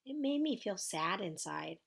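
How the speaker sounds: ordinary delivery, nothing marked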